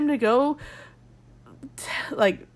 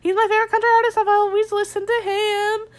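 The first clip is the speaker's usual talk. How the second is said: gushing fangirl; 10 semitones higher, 4 dB louder than usual. Retold or imitated. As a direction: squeal it like a starstruck fan. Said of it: "they're always like".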